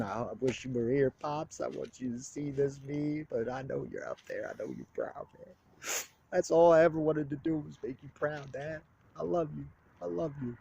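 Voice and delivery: barely holding it together while speaking to his late father; real grief, words falling to a broken hush; choked up. Real, not acted. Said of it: "I'm starting to get emotional".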